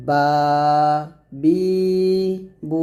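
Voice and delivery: বা, বি, বু each with its vowel held for one count; one-count vowel lengthening. Shown as a demonstration. Teacher gives madd examples, বা, বি, বু each drawn out. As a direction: stretch the open vowels of বা, বি, বু evenly. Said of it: "এক লিপ টেনে পড়তে হবে".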